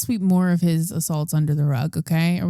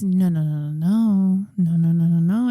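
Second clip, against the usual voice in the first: mock insistent no; 4 dB louder than usual. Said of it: "And she says".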